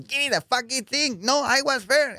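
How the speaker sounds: high put-on old lady squabbling; mock old lady. Old ladies fighting in the line, high mock squabble. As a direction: play a pushy old woman fighting for her spot. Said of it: "Old ladies were going".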